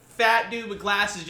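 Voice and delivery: usual speaking voice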